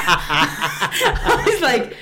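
laughing through the words; breaking into laughter mid-sentence. Real, not acted. Laughing while retelling mom's remark.

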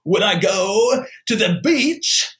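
put-on high-pitched, slow East Coast accent, breaking into a yell; crazy Cage accent. Co-host imitating Nick Cage's crazy East Coast accent. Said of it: "He's just doing this crazy voice".

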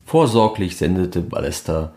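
ordinary talk, nothing marked

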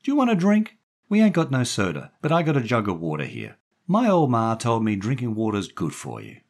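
high, loud woman's voice; chatty woman character. A narrator's reading. Daphne offering the boy a drink, chatty woman.

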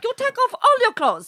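high mimicked masseuse voice. Masseuse ordering client to undress, high-pitched imitation. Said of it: "So she was like".

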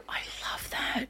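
hushed, whispered admiration; imitating the mums. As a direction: whisper it like an impressed mum. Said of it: "The mums have always been like".

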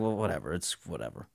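dropped-away, dismissive imitation; retold indifference. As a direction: toss it off like an unbothered boss.

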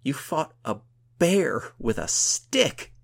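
loud, high, drawn-out disbelief; amazed exclamation. A narrator's reading. Gunhild marvels at her friend's fight, exclaiming in amazement. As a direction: savor every word, loud and astonished. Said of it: "she exclaimed".

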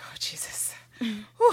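breathy dread whisper. Retold or imitated.

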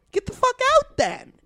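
high, sassy brush-off; high scornful dismissal; dismissive. Real, not acted. Rant dismissing the man.

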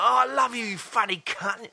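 put-on high gushing drunk fan; drunk stranger voice. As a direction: slur it like he's off his face. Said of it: "And he's like".

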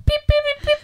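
very high comic beeps; high mock beeping. Clowning about the hated word snippet, high beeps. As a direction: beep in a silly, squeaky-high pitch.

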